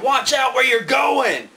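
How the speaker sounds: very high, loud, slow scream; frantic shout just before the crash; panicked yell. Retold or imitated. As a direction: scream a warning from the back seat. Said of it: "the mayor yells out of the back".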